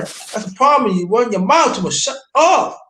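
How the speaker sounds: high-pitched, worked-up scolding; exasperated retold telling-off. Man telling his ex to shut up, acted exasperation. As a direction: replay the scolding, voice climbing high.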